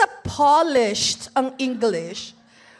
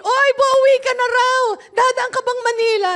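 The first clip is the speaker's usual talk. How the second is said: higher, louder calling out; nosy acquaintance; 6 semitones higher, 5 dB louder than usual. Retold or imitated. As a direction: imitate someone eagerly checking on travel plans. Voices someone asking about trip home. (malakas na pag-uusisa ng kakilala)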